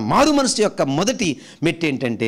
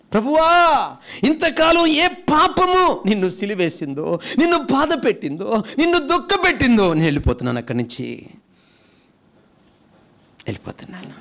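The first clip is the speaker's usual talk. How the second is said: a repentant believer renouncing sin, impassioned then faint; fervent prayer; 4 semitones higher than usual. Pretend. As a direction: cry out to the Lord, then let it die away. Voicing a prayer of turning away.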